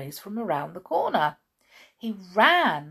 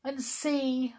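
higher, slow doleful dog voice; sad dog; 8 semitones higher, 5 dB louder, slower than usual. A narrator's reading. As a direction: lament slowly as a sorry little dog. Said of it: "said the dog sadly".

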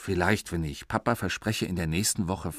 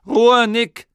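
high loud angry shout; 12 semitones higher, 11 dB louder than usual. Acted. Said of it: "hat Papa gerufen".

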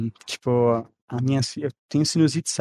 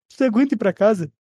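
higher, louder principal's question; a lightly voiced school principal, higher and louder; mock principal; 8 semitones higher, 4 dB louder than usual. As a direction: imitate the principal offering to send him home. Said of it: "Ela olhou assim".

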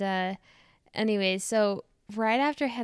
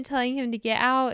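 ordinary delivery

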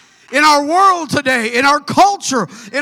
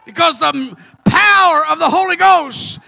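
fervent preaching; 3 semitones higher than usual. Acted. Preacher rallying the congregation to move forward, fervent. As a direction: hammer each "now I'm gonna".

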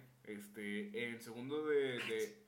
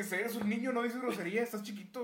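mock scolding; 8 semitones higher, 7 dB louder than usual. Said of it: "yo dije".

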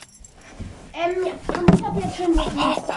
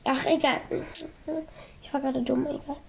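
ordinary talk

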